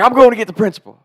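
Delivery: high, loud angry snap; furious threat. Retold or imitated. Lunch lady storming off to fetch the principal, loud and high.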